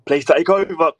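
gasping out words while choking; choking on a drink. Real, not acted. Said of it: "Sorry, I'm just choking".

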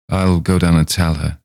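plain reading voice

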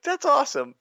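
high, loud mock-thrilled reaction; gushing quoted enthusiasm; retold excitement. Retelling barber's thrilled reaction.

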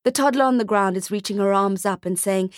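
ordinary delivery

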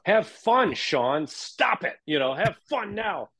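high-pitched, insistent order to have fun; play-scold yourself into having fun; jumpy, high mock command; self-coaching. Mimics telling himself to have fun.

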